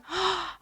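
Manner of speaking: soft, high acted gasp; quick frightened intake of breath. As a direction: gasp in alarm as Dorothy. Dorothy hears about the witch.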